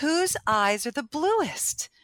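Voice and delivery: high, slow and teasing; drawn-out cheeky challenge; playful question. Retold or imitated. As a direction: tease the two of them playfully. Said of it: "and I go".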